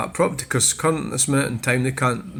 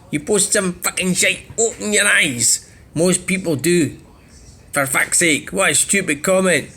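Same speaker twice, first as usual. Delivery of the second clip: higher, sneering read of a troll's comment; mocking troll voice, pitched up; mock comment-reader voice; 5 semitones higher than usual. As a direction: ham up the commenter's smug tone. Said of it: "I'll do it".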